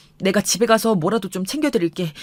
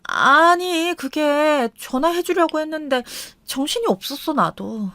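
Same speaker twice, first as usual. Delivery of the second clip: higher, flustered wife making excuses; wife caught out, fumbling an excuse; 5 semitones higher, slower than usual. Retold or imitated. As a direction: stammer out an excuse. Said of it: "움찔하더니 다시 둘러대는".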